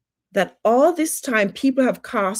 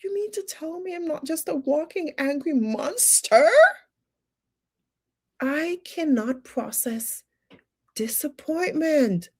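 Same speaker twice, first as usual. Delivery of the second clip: mock disbelief; 7 semitones higher than usual.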